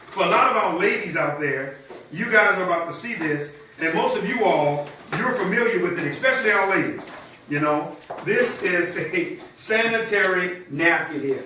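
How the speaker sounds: normal talking voice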